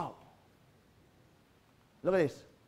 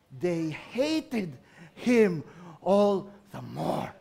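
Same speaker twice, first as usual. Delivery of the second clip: drawn-out, lower, weighty verdict; very slow; 5 semitones lower, much slower than usual. Acted. Preacher stresses the brothers' growing hatred, very slow and low. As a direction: drag each word out slowly. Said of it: "slow emphatic verdict".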